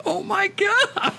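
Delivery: high, slow, squeaked through laughter; laughing. Real, not acted. Host still cracking up, high slow laughing voice. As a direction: stretch it out while laughing.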